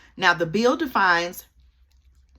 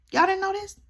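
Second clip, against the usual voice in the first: surprised. Real, not acted. Surprised viewers don't know.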